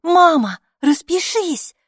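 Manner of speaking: put-on high, loud child nudging; a girl prodding her mother; urging girl. A narrator's reading. Hilla urges mom to sign, high loud child voice.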